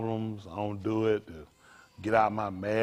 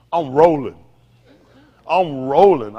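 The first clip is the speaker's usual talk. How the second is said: loud proud shout; loud declaration; 11 dB louder, slower than usual. Retold or imitated. Preacher boasting to the church he drives straight home, shouting. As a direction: belt it out like you're driving right past. Preacher acting out driving past.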